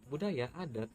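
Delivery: usual speaking voice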